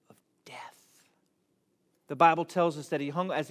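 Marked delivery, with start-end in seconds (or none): 0.1-0.5 solemn whisper. Real, not acted. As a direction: drop to a barely audible whisper. Solemn climax of scripture quote.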